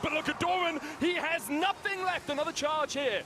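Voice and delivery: high, urgent shoutcaster call; excited play-by-play. Acted. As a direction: ride the teamfight, voice climbing. Hyped play-by-play on a teamfight.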